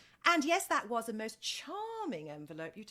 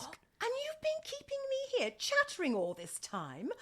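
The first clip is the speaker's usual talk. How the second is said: mock outrage; 12 semitones higher than usual. Mock scolding of secretary.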